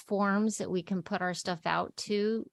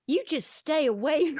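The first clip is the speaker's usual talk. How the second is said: put-on raised, playful Texas-accented warning; 7 semitones higher, 4 dB louder than usual. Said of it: "all of [name]'s characters have Texas accents".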